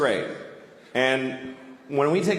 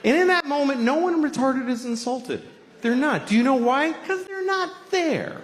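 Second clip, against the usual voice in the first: comic outrage. Real, not acted. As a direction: push the voice way up in disbelief. High-pitched comic ranting punchline.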